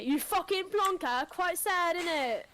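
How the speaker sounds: hammed-up British put-down; British accent. Plays a scornful Brit.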